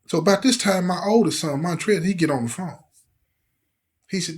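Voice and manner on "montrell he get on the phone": plain reading voice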